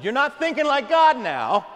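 the Lord's rebuke, high and loud; a preacher shouting Christ's rebuke to Peter; rebuking shout. Acted. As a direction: call him out sharply and loudly. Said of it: "He says".

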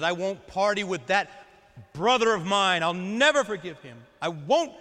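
resentful refusal. Acted. Older son refusing to celebrate his brother's return, bitter and loud. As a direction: dig in with resentment.

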